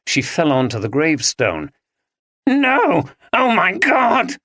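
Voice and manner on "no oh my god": high, slow horrified outcry; husband crying out after stabbing his wife. Acted. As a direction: cry out in shock at what he's done. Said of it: "cried Dominic".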